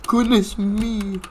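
high, loud, slow exclamation; loud, stretched-out dismay; rattled. Real, not acted. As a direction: draw it out in high-pitched shock. Recovering from scare.

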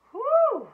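very high, loud whew; hot whew. Acted. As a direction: blow out from the heat. Hot snowman exhales.

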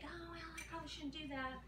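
gentle mock hesitation; mock doubtful viewer. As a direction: play the hesitant home cook, softly. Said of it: "you're gonna think".

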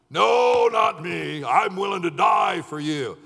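shouted, indignant denial; loud protest. Retold or imitated. Peter protesting to Jesus, a loud indignant shout. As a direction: insist it at full volume.

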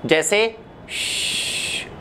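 श drawn out very slowly, breath only; slow sh demo. Shown as a demonstration. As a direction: stretch the श like a slow leak. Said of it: "पहले आप slowly practice कीजिए". (धीरे-धीरे लंबा श)

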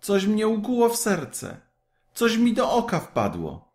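put-on high boyish voice, hurt and startled; a lightly voiced boy, complaining of a sting; boy in pain. A narrator's reading. Kaj tells Gerda something pricked him, high and hurt. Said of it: "zawołał nagle Kaj".

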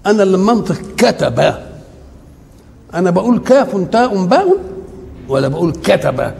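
ordinary delivery

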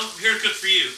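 ordinary talk

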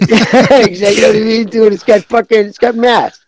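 put-on high, pushy salesman with Italian accent; Italian-accented car salesman. Caller selling an old Corolla, Italian-accented salesman. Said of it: "Italian accent when you're selling".

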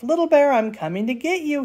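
hammed-up daddy bear roar; playful roar. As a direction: roar it out in fun like a big bear. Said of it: "he roars".

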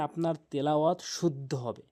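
normal talking voice

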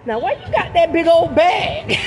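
high shouted mock complaint; mock scolding man. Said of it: "Fred will be like".

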